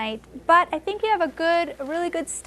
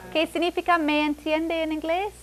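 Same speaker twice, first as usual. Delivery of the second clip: ordinary talk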